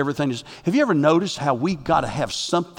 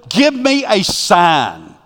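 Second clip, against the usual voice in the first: drawn-out, begging "Give me a sign"; mock pleading; 4 semitones higher, 5 dB louder, much slower than usual. Preacher mimicking believers begging God, slow pleading.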